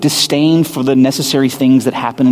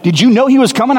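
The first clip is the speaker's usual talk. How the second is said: alarmed; 9 semitones higher, faster than usual. Retold or imitated. Coworker panics on learning of the CEO.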